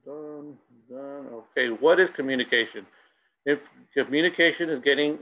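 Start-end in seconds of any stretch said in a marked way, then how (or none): 0.0-1.3 quiet aside. Real, not acted.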